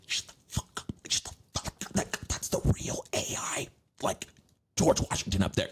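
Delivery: whispered, urgent hush; angry whisper. Retold or imitated. Crew member warning the actor in a whispered hiss. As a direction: shush him fiercely under your breath.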